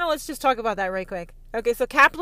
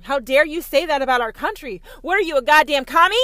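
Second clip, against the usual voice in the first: mock outraged patriot; 3 semitones higher, 8 dB louder than usual.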